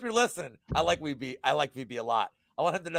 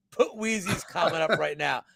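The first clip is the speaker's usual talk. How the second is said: ordinary talk, nothing marked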